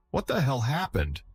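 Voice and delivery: high-pitched, falling exclamation; mildly astonished question; incredulous demand. A narrator's reading. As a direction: demand an explanation in disbelief. Officer arrives, demands an explanation.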